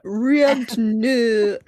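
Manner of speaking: "Riod Nu" intoned like eldritch words; backwards speech. Shown as a demonstration. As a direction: say "Riod Nu" like a strange incantation. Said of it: "that sounds more Cthulhu".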